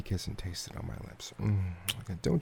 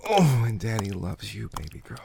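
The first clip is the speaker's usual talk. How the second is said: usual speaking voice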